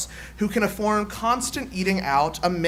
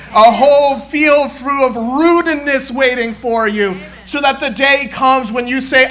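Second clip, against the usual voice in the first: shouted, high call-and-response chant, building and driving; rally the congregation like a fired-up preacher; preaching at full force; 7 semitones higher, 11 dB louder than usual. Acted. Preacher leading the congregation in a chant, shouting at full force.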